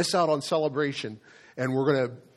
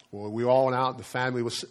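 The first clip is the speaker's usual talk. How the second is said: ordinary talk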